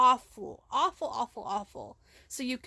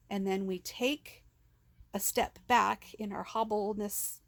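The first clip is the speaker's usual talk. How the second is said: normal talking voice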